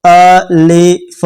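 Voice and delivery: drawn-out अलिफ, said slowly for learners; slow demo alif. Shown as a demonstration. Teacher demonstrating अलिफ slowly for the class. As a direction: stretch अलिफ so students can copy. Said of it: "आप मेरे साथ बोल के देखो".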